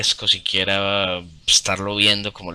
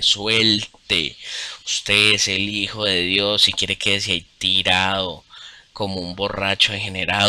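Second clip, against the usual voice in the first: calm divine voice; slower than usual. Retold or imitated. The voice tells him to let go, slow and firm. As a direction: speak as a patient god releasing his burdens, slowly. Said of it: "y empieza la voz".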